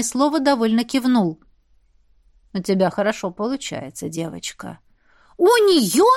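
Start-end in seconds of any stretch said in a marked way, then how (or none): 2.5-4.8 put-on quiet, low elderly voice; low old man voice. Old shaman Dref speaks. 5.4-6.1 put-on indignant child. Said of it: "возмутились мальчики".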